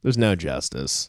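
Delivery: There's no justice dropped low and slow; a deep, hammed-up There's no justice; mock despair. Shown as a demonstration. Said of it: "repeats it lower, slower".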